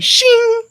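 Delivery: very high, ringing "shing"; shovel-fling sound. Retold or imitated. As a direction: imitate something whizzing up off a shovel. Mimics flinging mess skyward.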